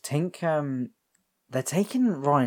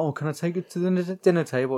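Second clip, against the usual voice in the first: put-on higher child's begging voice; pleading kid; 7 semitones higher than usual. Kid wanting the new toy at dinner, pleading. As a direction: ask like an eager kid. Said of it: "when you're a kid".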